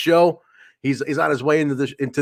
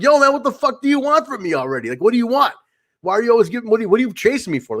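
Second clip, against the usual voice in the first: higher-pitched, exasperated challenge; retold freaked-out demand; 8 semitones higher, faster than usual. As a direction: act out Kyle confronting his pursuer, pitch jumping up. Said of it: "kinda freaked out at this point".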